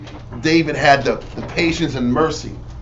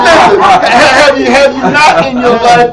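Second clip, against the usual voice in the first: high, loud, laughing outburst; excited laughing rant; 9 semitones higher, 6 dB louder than usual. Real, not acted. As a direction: laugh and shout through the story.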